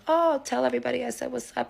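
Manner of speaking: high, loud, breezy phone reply; fake cheeriness. Woman to her man at the bar, falsely cheery. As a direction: overdo the friendliness to cover suspicion. Covering suspicion with cheery greeting.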